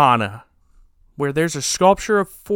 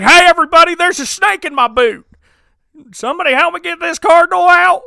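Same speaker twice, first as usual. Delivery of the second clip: higher and louder, a hammed-up movie-hero call for help; Tom Hanks impression; 13 semitones higher, 8 dB louder than usual. Langdon calling for help in the fountain, hammed-up Tom Hanks. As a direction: shout it like a bad Tom Hanks. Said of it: "That's my best Tom Hanks impersonation".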